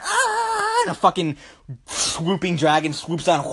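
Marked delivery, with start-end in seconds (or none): 0.0-0.8 a high scream of running people; mock panicked scream. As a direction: cry out in mock terror, high. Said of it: "People are running".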